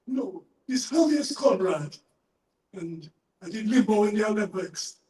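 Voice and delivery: put-on Hermes in a Jamaican accent. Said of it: "can you do a Jamaican accent".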